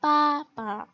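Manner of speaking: पापा with a strong first syllable, light second; पापा falling to an unaccented ending; neutral tone demonstration. Shown as a demonstration. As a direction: stress the first पा, drop the second. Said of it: "neutral tone".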